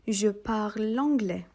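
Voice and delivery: slow, clear French phrase; slow model phrase. Shown as a demonstration. Teacher to students, slow model phrase. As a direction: say it slowly for learners to copy. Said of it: "yeni diller ekliyoruz".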